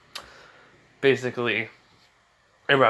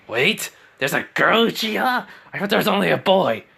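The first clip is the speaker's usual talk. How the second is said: higher-pitched acted disbelief; surprised; 6 semitones higher than usual. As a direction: blurt it out, puzzled and incredulous. Orochimaru startled by a reveal.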